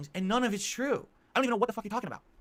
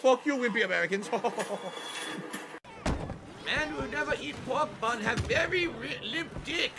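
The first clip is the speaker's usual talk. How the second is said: mock Japanese accent; 3 semitones higher, 4 dB louder than usual. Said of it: "that's a Japanese accent".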